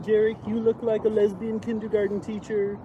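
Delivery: brash heckling Trump voice; Donald Trump impression. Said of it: "In the voice of Donald Trump".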